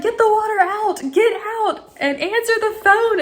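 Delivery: high, urgent self-command; frantic. Retold or imitated. Woman hurrying out of shower to phone, frantic. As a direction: order yourself out in a high panic.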